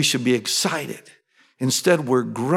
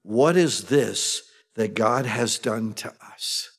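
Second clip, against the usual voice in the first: softer, lower and slow, a frightened murmur; afraid; 6 semitones lower, 5 dB quieter, slower than usual. Acted. Brothers ask one another, softly and afraid. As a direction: let the fear hush the question. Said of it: "They were afraid".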